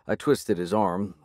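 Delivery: usual speaking voice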